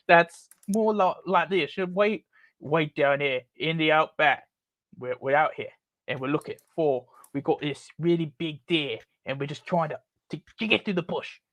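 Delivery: put-on Australian bush accent; Australian outback accent. Shown as a demonstration. Demonstrating the blended accents, an outback Australian character looking for deer. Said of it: "the Australian accent is".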